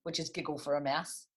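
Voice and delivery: lively storybook rhyme reading; reading to children. A narrator's reading. Teacher reads Custard the Dragon to the class, lively storybook voice. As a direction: bounce the rhymes for little listeners.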